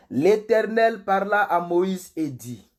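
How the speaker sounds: slow, loud, drawn-out scripture proclamation; solemn scripture reading. Acted. Pastor opening the Bible reading to online viewers, solemn proclamation. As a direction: read it out grandly, stretching the words.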